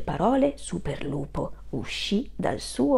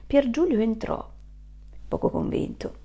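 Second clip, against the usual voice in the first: plain reading voice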